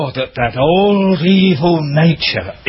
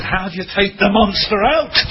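higher, eager questioning voice; quoting the young man, pitched well up; 9 semitones higher than usual. Retold or imitated. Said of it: "But he said".

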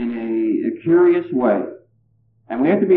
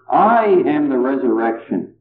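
emphatic "I", then let "am the resurrection" drop; stress on I. Shown as a demonstration. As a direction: lean hard on "I" at the start. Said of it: "the emphasis should go on the first word".

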